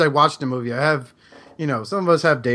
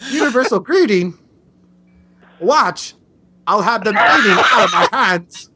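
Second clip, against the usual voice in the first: higher, mock Walken drawl with odd pauses; Christopher Walken; 6 semitones higher, 5 dB louder than usual. Reading a cartoon line to a fan as Walken, hammed up. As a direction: lay on the Walken cadence, pausing oddly mid-line. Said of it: "as Christopher Walken".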